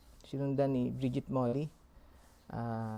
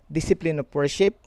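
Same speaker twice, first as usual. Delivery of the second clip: usual speaking voice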